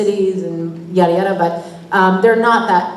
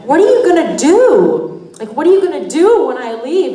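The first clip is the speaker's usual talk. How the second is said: put-on sing-song Minnesota accent, higher, swooping on "do"; 6 semitones higher than usual. Teasing the crew about when she leaves, Minnesota accent. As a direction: tease in a broad Minnesota lilt. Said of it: "constantly talking in the accent".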